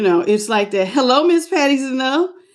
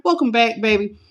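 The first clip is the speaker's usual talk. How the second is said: ordinary talk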